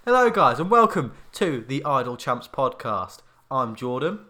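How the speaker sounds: loud, slow, upbeat host welcome; podcast host intro. Acted. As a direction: open the show like a presenter.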